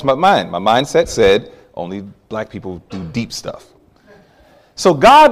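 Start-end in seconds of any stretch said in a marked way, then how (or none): 1.7-3.7 near-whispered aside. Retold or imitated. Mindset voiced quietly.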